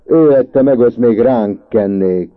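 loud, high indignant yell; shouting. A narrator's reading. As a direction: shout it back in outrage. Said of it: "kiabált Tök Marci".

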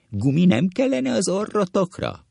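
high, sneering put-on voice; mock them with a shrill jeer; mocking taunt.

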